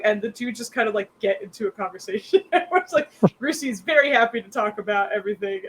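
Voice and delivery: ordinary talk, nothing marked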